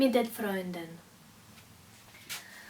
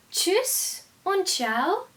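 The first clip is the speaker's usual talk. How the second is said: slow demo of informal goodbyes; 6 semitones higher, slower than usual. Shown as a demonstration. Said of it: "sind informell".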